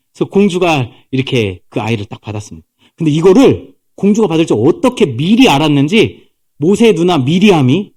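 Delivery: ordinary talk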